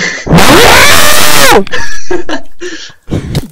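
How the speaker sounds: high, loud yelping fox call; fox cry imitation. Shown as a demonstration. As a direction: let out a shrill fox bark. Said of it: "Taklit et".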